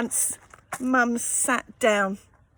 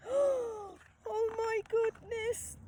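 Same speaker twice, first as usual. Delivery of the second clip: much higher but much softer, a breathy gasp of dismay; hushed shock; 10 semitones higher, 11 dB quieter, slower than usual. A narrator's reading. Reader reacting for the child listener, hushed shock. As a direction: gasp it out in quiet alarm. Narrator reacts to mum shouting.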